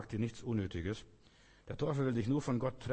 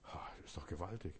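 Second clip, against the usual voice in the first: very soft, low whisper of wonder; whispered awe; 6 semitones lower, 11 dB quieter than usual. Real, not acted. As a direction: breathe it out in amazement. Preacher marvels at the promise. (ehrfürchtig geflüstert)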